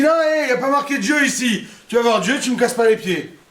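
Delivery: high, loud brush-off; curt husband. Acted. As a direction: dismiss her impatiently. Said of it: "Le mec, il dit". (mari qui la rembarre, voix haute et forte)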